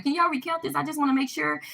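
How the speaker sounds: polite, nervous customer asking for a recount; pleading request. Retold or imitated. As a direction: ask the desk nicely but worried. Asks service desk to recount.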